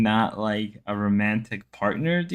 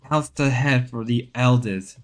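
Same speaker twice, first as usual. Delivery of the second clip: nature documentary. Pretend. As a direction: narrate seals like a documentary. Documentary narration continues.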